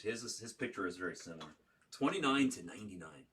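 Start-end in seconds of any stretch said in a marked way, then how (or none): 1.9-3.2 loud, high hit announcement; card break host. Acted. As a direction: call out the serial number big.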